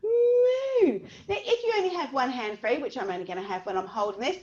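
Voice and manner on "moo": sing-song cow moo. Shown as a demonstration. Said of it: "we go".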